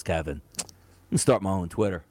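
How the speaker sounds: low, laid-back LeBron voice. Retold or imitated. Podcast host mimicking LeBron announcing his own Twitter, low and casual.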